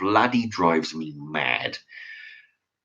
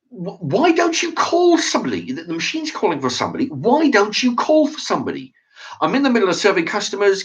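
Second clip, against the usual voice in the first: exasperated demand; 9 semitones higher than usual. Retold or imitated. Retold argument with the clerk.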